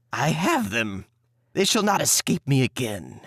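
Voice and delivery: gloating witch voice, a touch of malice; mocking witch. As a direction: savour the catch, mildly mocking. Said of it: "she laughed with malice and said mockingly".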